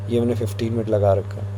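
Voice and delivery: normal talking voice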